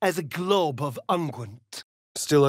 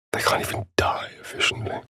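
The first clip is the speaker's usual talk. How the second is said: whisper; faster than usual. Acted.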